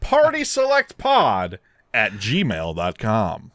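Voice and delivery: very slow, measured delivery for a listener; slow, deliberate announcing. Real, not acted. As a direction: spell out the address word by word. Repeats email address on request.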